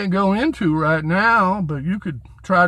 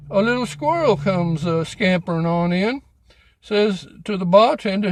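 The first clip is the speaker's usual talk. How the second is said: ordinary talk, nothing marked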